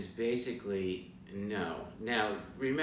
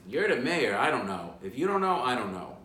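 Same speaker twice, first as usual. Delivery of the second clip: exasperated retort; 3 semitones higher, 6 dB louder than usual. Retold or imitated. Guide retelling his comeback to the mayor, exasperated.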